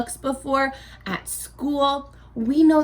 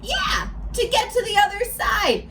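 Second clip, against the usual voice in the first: excited punchline; 10 semitones higher than usual. Shown as a demonstration. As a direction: burst out with the answer like it's obvious. Teacher delivers punchline, shout heard.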